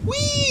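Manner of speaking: very high "whee"; playful squeal. Real, not acted. Player moving about in game, playful squeal.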